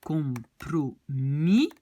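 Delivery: compromis said slowly with a Dutch ending; Dutch pronunciation. Shown as a demonstration. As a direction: stretch compromis out, Dutch style. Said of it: "on va dire en néerlandais".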